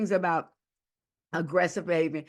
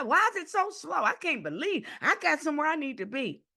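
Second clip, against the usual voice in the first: impatient aggressive customer; 9 semitones higher than usual. Retold or imitated. Impatient customer in the grocery line, whining high and pushy. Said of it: "say something like".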